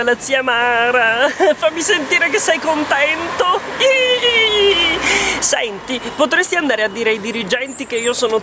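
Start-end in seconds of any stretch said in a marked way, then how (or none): 3.8-5.0 horse whinny. Pretend. Asks horse to show happiness.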